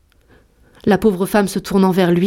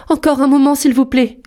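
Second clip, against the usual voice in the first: supplication; 7 semitones higher, 4 dB louder than usual. Acted. Épouse implore son mari.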